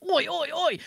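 caricatured Chav call; Chav accent. Shown as a demonstration. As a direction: demonstrate a put-on British Chav accent. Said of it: "the Chav accent where it's like".

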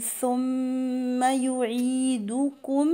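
"ثم" with a two-beat hummed m; tongue-tip th, then hold the م of "ثم"; ghunnah on mim. Shown as a demonstration. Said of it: "والميم المشددة غنة بمقدار حركتين".

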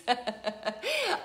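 high giggle of amusement; tickled laugh. Real, not acted. Host tickled by viewers' hearts, high amused laugh. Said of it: "they do tickle me".

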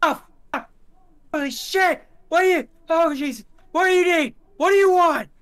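very high, loud panicked shrieking; freaking out. Acted. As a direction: yell in frantic bursts, pleading with an attacker. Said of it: "freaking out".

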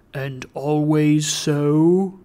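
slow, solemn spectral questioning; questioning bell goblin. A narrator's reading. Goblin of the bell questions Trotty, solemn. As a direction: question him slowly and gravely.

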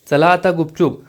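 usual speaking voice

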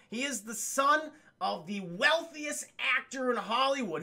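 ordinary talk, nothing marked

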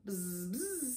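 two soft bee buzzes; soft storybook bee noise. A narrator's reading. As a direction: buzz quietly like a bee. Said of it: "says Bee".

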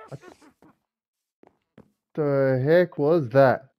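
slow, loud startled question; startled drawn-out. Real, not acted. Player hears a strange noise, slow startled question. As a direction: stretch it out in alarm.

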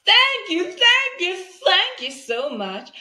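very high, loud, bursting thank you; gushing thanks. Real, not acted. Host thanking viewers for watching to the end. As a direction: squeal your gratitude. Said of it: "when I'm gonna say".

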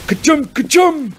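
two high, loud play-acted sneezes; mock double sneeze. A narrator's reading. Joe pretending to be Billy on peppered worm, mock sneezes. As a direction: sneeze twice, theatrically. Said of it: "Joe sneezing".

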